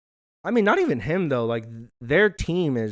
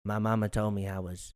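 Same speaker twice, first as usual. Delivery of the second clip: soft, pitched-down rapper imitation; 5 semitones lower, 6 dB quieter than usual. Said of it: "like, when he goes".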